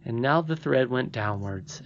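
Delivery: plain reading voice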